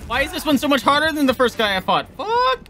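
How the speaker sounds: loud, high frustrated yelling; frustrated shout. Real, not acted. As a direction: yell it out at the game. Losing a hard fight.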